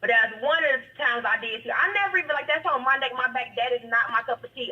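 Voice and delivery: ordinary delivery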